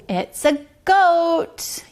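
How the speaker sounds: slow, high, drawn-out reveal; delighted answer to a guessing child; excited reveal. Acted. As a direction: stretch it out like a big surprise. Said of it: "big reveal to child".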